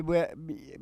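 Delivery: normal talking voice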